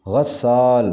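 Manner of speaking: غسال with a short a first, "ghassaal"; correct pronunciation. Shown as a demonstration. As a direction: say غسال as ghas-SAAL. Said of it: "صحیح تلفظ ہے".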